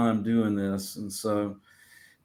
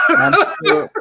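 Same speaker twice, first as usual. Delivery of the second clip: laughing; 9 dB louder than usual. Real, not acted. Laughing about craving barbecue.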